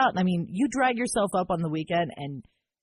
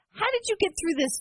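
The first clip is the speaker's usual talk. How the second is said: high, slowed pleading voice; mock desperate plea; pleading question; 10 semitones higher than usual. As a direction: beg for advice like a worn-out parent. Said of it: "and I said".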